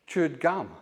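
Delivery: ordinary delivery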